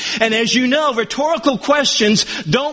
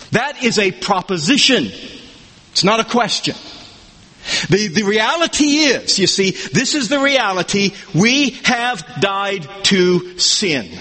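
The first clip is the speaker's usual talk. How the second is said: ordinary talk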